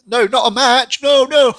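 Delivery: high, loud panicked no; panicked refusal. Retold or imitated. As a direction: back out in a squealing panic. Miz panicking over booked match.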